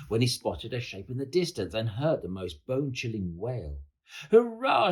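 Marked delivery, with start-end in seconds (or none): 4.3-4.9 high, loud cheer; excited shout. A narrator's reading. George hails the shape he takes for Yeti, excited shout. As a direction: cheer as if finally victorious. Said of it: "shouted George".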